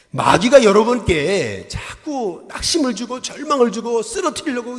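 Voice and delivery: loud sermon push; a pastor calling the faithful to question despair, loud and fervent; fervent preaching. Acted. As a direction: rouse the congregation, full voice. Preaching at full force.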